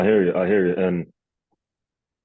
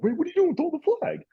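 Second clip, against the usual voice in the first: much higher, squeaky mock coach pleading for a flag; imitating frantic coach; faster than usual. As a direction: play the coach begging the ref. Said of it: "you got Sean Payton running up and down, like".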